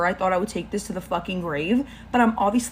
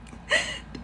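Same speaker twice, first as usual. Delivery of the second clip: high, airless giggle; embarrassed laugh; 6 dB quieter than usual. Real, not acted. Storyteller laughing at her own witchcraft confession. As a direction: crack up mid-story. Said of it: "I can't say this seriously".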